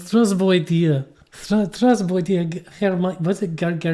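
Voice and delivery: slow, exaggerated mock Russian greeting; a clumsy Russian hello, slow and exaggerated; stupid fake Russian accent. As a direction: drag it out in a hammed-up Russian voice. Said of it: "making that stupid accent".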